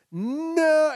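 loud, high, drawn-out dismay; hammed-up panicked shout; mock horrified cry. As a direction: cry out as if it's slipping from your hand. Acts out watching phone fall.

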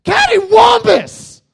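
huge high roaring yell; furious outburst. Retold or imitated. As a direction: explode with rage at the sight. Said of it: "he must have been like".

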